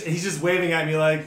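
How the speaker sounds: ordinary talk, nothing marked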